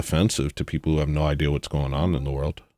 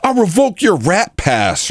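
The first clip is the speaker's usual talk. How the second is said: high, slow mock pomp; lofty whiny parody of the host; mocking Rosenberg; 12 semitones higher, 3 dB louder, slower than usual. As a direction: draw it out in a high prissy voice. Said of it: "He's like".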